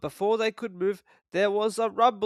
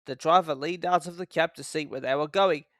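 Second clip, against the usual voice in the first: ordinary delivery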